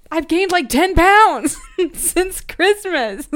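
high, loud, drawn-out outburst; loud laughing confession. Real, not acted. Confessing weight gain after a trigger warning, loud and laughing. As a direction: blurt it out, breaking into laughter.

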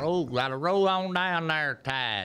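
slow Southern drawl; Southern accent. Pretend. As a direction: roll it out like a good old boy. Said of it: "Southern accent".

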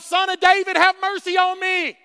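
high-pitched pleading call; crying out. Retold or imitated. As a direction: call out over a crowd, high and loud. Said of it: "he cried out".

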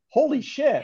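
high-pitched mock shock; imitated father's reaction. Retelling the father's response to the shark, mock startled exclamation. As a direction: exclaim as the startled movie dad. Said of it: "the father's response is".

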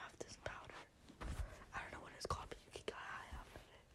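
secretive unvoiced whisper. Real, not acted. Whispering about powder.

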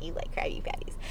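soft, barely voiced cartoon quote; hushed light impression of a smug cartoon; SpongeBob. Showing how his face moves up, soft SpongeBob. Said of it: "he's like".

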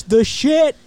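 excited emphatic praise; hyped. Real, not acted. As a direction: hype it up big. Enthusiastic praise of home ec.

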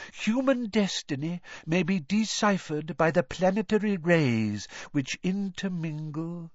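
high, dreamy fortune-teller; mystical seer voice. A narrator's reading. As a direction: intone it like an oracle.

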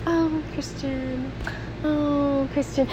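swooning over a crush at work; dreamy swoon. Pretend. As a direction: breathe it out like a daydream. Anna daydreaming at work.

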